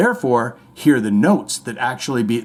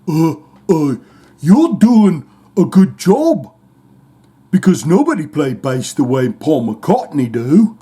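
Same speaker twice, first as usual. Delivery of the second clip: [name]; 5 dB louder than usual. Retold or imitated. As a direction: put on an exaggerated British musician voice. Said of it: "might be [name] coming over and saying".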